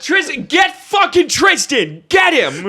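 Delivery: high, loud, drawn-out shouting; frantic parent yell. Retold or imitated. Imitating parents chasing Tristan, frantic shouting. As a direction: bellow orders to catch the kid. Parent yelling to catch child.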